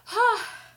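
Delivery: sharp high sigh, close to crying; shaky sob sigh. Real, not acted. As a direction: let out a loud breaking sigh. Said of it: "doing my best not to cry".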